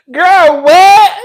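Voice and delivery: loud, high burst of disbelief; shocked disbelief. Real, not acted. Streamer reacting to Suki's street money claim, loud shock.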